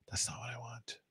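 breathy aside under the breath; whisper. Real, not acted. Page shows a French greeting, he whispers an aside. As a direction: whisper it to yourself, annoyed. Aside on wrong search result.

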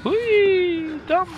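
very high, drawn-out squeal of a word; high playful whee. Real, not acted. Player reacts to steam in the game, high-pitched whee. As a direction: let out a long, high whee.

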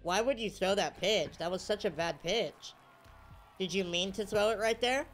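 normal talking voice

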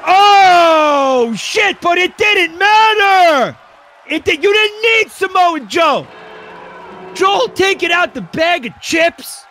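very high, loud, drawn-out shouts; screaming play-by-play. Pretend. Commentator screaming as Joel takes out the chips, frenzied. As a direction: lose it completely at the big finish.

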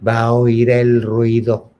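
slow, drawn-out warning; slow and deliberate. Retold or imitated. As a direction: stretch each word out slowly. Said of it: "así hablaba como lento".

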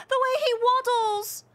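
high, slow, squealing delight; overwhelmed by cuteness. Real, not acted. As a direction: squeal it out, near tears with glee. Overjoyed at the waddling character.

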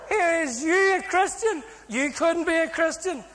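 high-pitched incredulous cellmate; mocking disbelief. Cellmate mocks his talk of converting.

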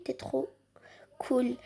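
normal talking voice

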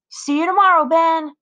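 put-on boy's voice. A narrator's reading. Ryan's farewell on the bus.

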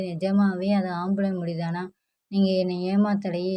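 ordinary delivery, nothing marked

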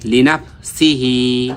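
লিনাফ সিহি slowly, final হি held long; short সি, then stretch out হি; elongated ending. Shown as a demonstration. Teacher joining the word, slow লিনাফ সিহি with drawn-out ending. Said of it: "মাদের হরকত".

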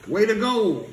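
high mock cheer; mocking the cards with a squeaky cheer; sarcastic. Real, not acted.

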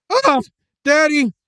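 high, sharp cry of disgust; girl's shriek. Acted. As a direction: shriek for her daddy. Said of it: "Emily screamed".

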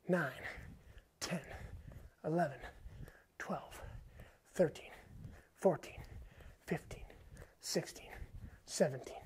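strained, whispered counting between reps; breathless counting. Real, not acted. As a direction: gasp the numbers out mid-exercise. Counting reps mid-exercise.

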